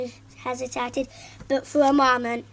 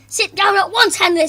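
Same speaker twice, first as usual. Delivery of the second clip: loud, higher teacher command; stern order; 7 semitones higher, 8 dB louder than usual. Acted. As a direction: order him to sit. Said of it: "said Miss Battleaxe".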